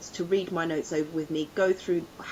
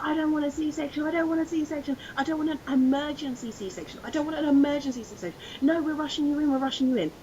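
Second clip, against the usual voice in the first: desperate protest; 9 semitones higher than usual. Retold or imitated. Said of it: "I was like".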